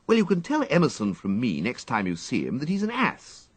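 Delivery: brushing off the quote; airy upper-class retort; dismissive young toff. A narrator's reading. Wooster's voice in story.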